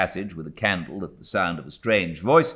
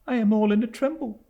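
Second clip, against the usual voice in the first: higher, quick and a little trembling; faltering; 5 dB louder, faster than usual. A narrator's reading. Frightened widow to stern aunt, mild faltering. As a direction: fluster out the words, mildly. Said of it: "faltered my mother".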